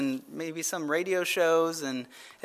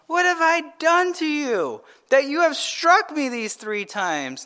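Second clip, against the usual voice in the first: higher, lightly voiced donkey, plaintive; a hint of a wounded animal asking why; talking donkey; 6 semitones higher than usual. Donkey speaks in scripture reading.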